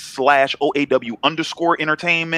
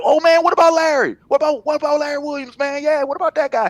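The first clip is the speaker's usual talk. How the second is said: high-pitched fan blurting out a name; excited fan; 12 semitones higher than usual. Acted. Readers bringing up a favourite writer in conversation, excited high voice. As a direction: put on an eager, much higher voice. Imagined fans bringing him up.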